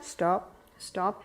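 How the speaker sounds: low, soft, steady command; soft firm stop. Shown as a demonstration. Parent laying a crying baby back down, soft and firm. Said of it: "put them back down".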